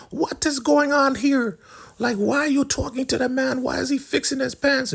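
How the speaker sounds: imitated indignant woman in falsetto; put-on falsetto demanding answers; high-pitched interrogation. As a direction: squeal the questions in a very high mock voice. Acting out outraged Elder Mother confronting her.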